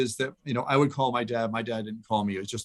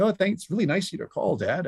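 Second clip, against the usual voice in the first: chirpy thanks, pitched up high; bright polite thanks; 7 semitones higher than usual. Retold or imitated. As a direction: sound pleasantly surprised and overly polite. Thanking dad in the retold call.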